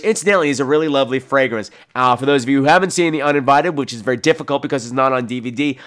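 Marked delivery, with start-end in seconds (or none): none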